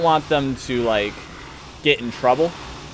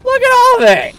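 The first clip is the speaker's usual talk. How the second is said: high, loud disbelieving shout; shocked outcry; 13 semitones higher, 9 dB louder than usual. Real, not acted. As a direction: yell it in sheer disbelief. Outraged at enemy army.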